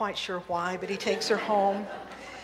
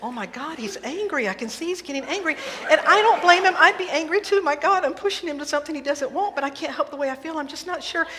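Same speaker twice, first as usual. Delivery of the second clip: higher and louder panicky rush; frantic worry; 7 semitones higher, 6 dB louder than usual. Acted. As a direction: Elaine panicking silently that Roger is angry, higher and louder. Elaine frantic inner voice.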